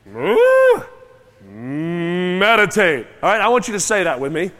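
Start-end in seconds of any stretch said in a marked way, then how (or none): 0.1-3.1 sudden high loud "Mm" like a cow's moo before "meditate"; a loud mooing "Mm" leading into "meditate"; high loud moo then word. Shown as a demonstration. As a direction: jump way up on "Mm", then say "meditate" plainly. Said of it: "I say it like this".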